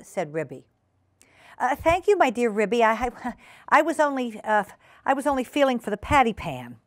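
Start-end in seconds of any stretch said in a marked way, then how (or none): 1.6-6.8 higher, stammering, caught-out guest; flustered guest Duchess. Acted. Duchess excusing her fumbling to Ribby, flustered. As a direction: stumble politely through an excuse.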